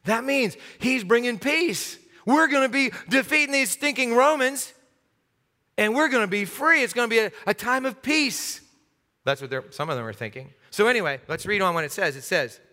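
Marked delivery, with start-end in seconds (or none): 0.1-8.6 higher-pitched, eager crowd voice; excited believer. Acted. Crowd member explaining the donkey means peace, excited. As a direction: proclaim the hope eagerly, pitched up.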